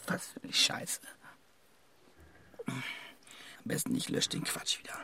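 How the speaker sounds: a soft laugh, then a whispered curse; muttered whisper, embarrassed at himself; whispered self-disgust. Acted. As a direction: scoff quietly at your own writing. Disgusted with own text.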